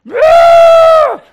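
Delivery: loud, high imitated baby wail; sudden piercing mock cry; imitated baby outburst. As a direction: blast out a baby's indignant shriek. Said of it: "and she went".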